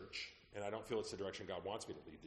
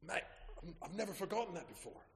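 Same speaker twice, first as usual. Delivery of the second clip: higher, bewildered voice of a man who lost his words; a confused warlock, pitched up; puzzled warlock; 9 semitones higher than usual. Retold or imitated. Warlock forgets his incantation.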